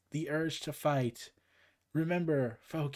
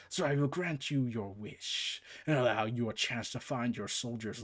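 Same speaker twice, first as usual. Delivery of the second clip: deep quiet god voice laying down terms; Hades decree; 4 semitones lower, 3 dB quieter than usual. Acted. Hades grants her quest.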